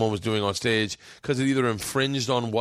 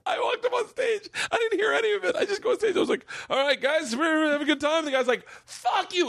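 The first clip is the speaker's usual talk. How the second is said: much higher, words squeezed out through laughter; real laughter cracking up the story; laughing while telling. Real, not acted. Laughing through his own story.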